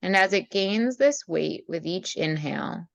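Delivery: calm meditation voice with long pauses; guided meditation. Acted. Leads a meditation.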